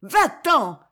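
high, loud cry of despair; frantic madwoman's shout; desperate cry. A narrator's reading. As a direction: cry out to drive someone away. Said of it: "cria la folle d'une voix désespérée".

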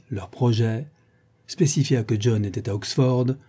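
ordinary delivery

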